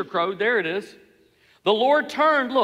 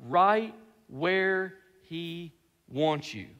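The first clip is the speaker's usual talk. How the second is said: very slow, lower, word by word; deliberate warning; 6 semitones lower, 4 dB quieter, much slower than usual. Real, not acted. Preacher warning about the devil's trap, deliberate. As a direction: space out every word gravely.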